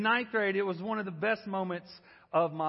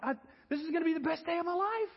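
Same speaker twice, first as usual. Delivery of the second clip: much higher, fast, breathless thrill; giddy excitement; 8 semitones higher, faster than usual. Retold or imitated. Boy thinks aloud while running unblocked, giddy and rushed. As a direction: rush it out, thrilled. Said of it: "And I'm like".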